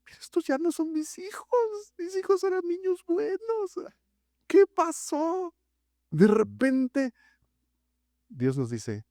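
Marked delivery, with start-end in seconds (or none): 0.0-5.5 much higher, bewildered lament; a parent in disbelief; dismayed parent. Retold or imitated. Parents bewail their grown children, high dismayed lament. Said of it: "Los padres de repente decimos".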